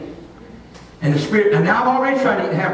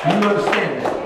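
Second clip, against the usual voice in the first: raised, emphatic sermon voice; fervent preaching; 6 semitones higher than usual. Real, not acted. Preacher urging Rocky Mount to hear God, fervent preaching. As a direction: press the message on the congregation.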